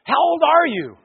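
high-pitched nosy question; teens' blunt question. Retold or imitated. Teens asking the camp speaker his age, high-pitched question. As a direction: shoot the pitch right up, mimicking a teen. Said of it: "they go".

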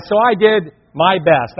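ordinary talk

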